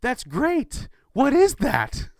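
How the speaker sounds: gush, then blank confusion; mock baffled delight. Old hero hearing of the Avengers, hammed-up squeaky bafflement. Tobey clueless about the Avengers.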